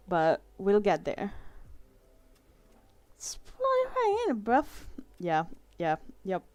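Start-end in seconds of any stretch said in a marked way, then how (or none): 3.2-4.7 high, soft put-on British accent; playful British accent, pitched way up; mock British slang. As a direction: squeak it like a cartoon Brit.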